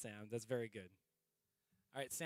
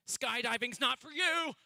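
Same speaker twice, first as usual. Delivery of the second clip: punchline; 13 semitones higher, 10 dB louder, slower than usual. Acted. Punchline of a joke.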